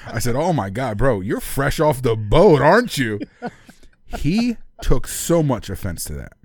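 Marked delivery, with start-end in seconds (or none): 0.4-3.2 slow, loud, stretched-out teasing; loud mocking taunt. Storyteller re-enacts taunting the kid, loud and drawn out. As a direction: hammer it out slowly, getting louder. Retold taunt to offended stranger.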